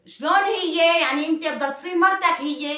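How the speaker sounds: loud, high, indignant outburst; outraged disbelief. Acted. Wife realising her husband wants another wife, outraged and loud. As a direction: erupt as the truth dawns on you.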